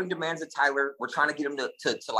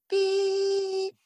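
long, very high beep; imitated whistle. Storyteller imitating rescuers' whistle from the beach, imitated whistle. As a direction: mimic a distant whistle blast, held long. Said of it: "people blowing a whistle".

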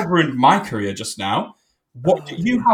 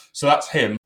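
normal talking voice

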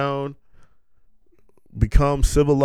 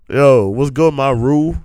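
loud bro greeting; 2 semitones higher, 7 dB louder than usual. Pretend. Greeting a kangaroo homie.

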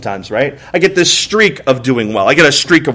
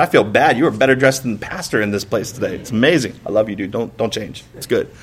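lower, softer, friendly aside to a well-dressed guy; retold compliment; 7 semitones lower, 8 dB quieter than usual. Pastor teasing the man in a shirt and tie, softly fond. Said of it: "I told him, I said".

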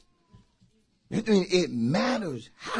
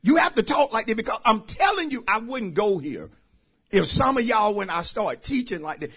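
ordinary talk

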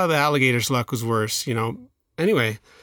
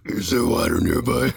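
lower, weak voice of a dying alligator; weak talking alligator; 4 semitones lower than usual. Acted. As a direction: try out a faint creature voice for the alligator. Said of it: "How do alligators talk?".

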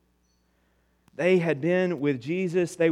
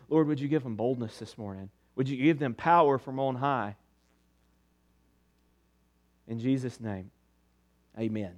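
soft, low closing prayer; hushed, earnest prayer to close; 7 semitones lower, 8 dB quieter than usual. Acted. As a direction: pray quietly over the room. Closing prayer over the congregation.

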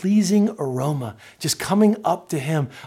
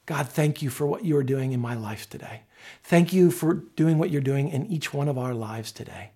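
lower, softer, reverent prayer; quiet prayer; 5 semitones lower, 6 dB quieter than usual. Real, not acted. Pastor thanking God in Jesus' name, quiet prayer. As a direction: bring the prayer gently to a close. Let's pray.

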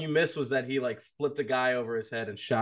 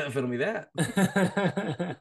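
drawn out silly; 3 semitones higher, much slower than usual. Pretend. Riffing on fiddle joke.

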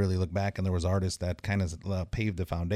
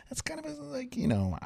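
squeaky, embarrassed dodge, much higher; hedging nervously up high; awkward high hedge; 3 dB quieter than usual. Retold or imitated. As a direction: squirm out of it in a high voice. His own awkward reply retold.

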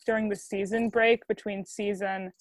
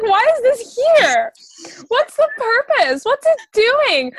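high, loud baffled questions; squeaky exasperated puzzlement; incredulous; 12 semitones higher, 7 dB louder, slower than usual. Retold or imitated. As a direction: pitch up in disbelief, slow. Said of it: "I was like".

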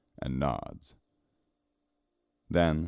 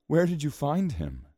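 high-pitched eager question; excited; 12 semitones higher, 3 dB louder than usual. Acted. Girl asks who found her cat, high-pitched excitement. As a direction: ask it with lifted, girlish excitement. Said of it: "she says excitedly".

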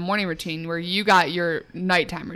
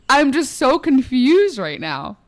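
excited; 6 semitones higher, 7 dB louder than usual. Real, not acted. Reacting to mic flinging.

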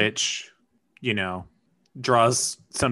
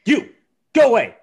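shouting; 12 semitones higher, 9 dB louder, faster than usual. Pretend. Shooing a ghost away.

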